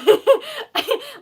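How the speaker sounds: a sudden high burst of laughter; loud high laugh; bright laugh. Real, not acted. Laughing at own joke.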